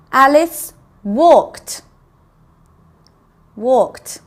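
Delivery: slow, loud Alice walked, then WALKED alone ending in T; teacher isolating the T ending of walked; T sound at the end. Shown as a demonstration. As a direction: stretch Alice walked and finish walked on a clear T. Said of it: "that's the T sound".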